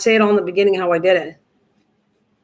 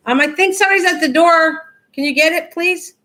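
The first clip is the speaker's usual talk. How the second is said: high-pitched call across the house; calling out to family; 7 semitones higher than usual. Real, not acted. Asking family members to answer the door, calling out.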